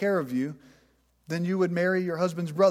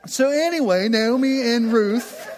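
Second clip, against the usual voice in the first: laughing it off, high and loud; trying to get back on track through laughter; 4 semitones higher, 4 dB louder, slower than usual. Real, not acted. Laughter carrying into story.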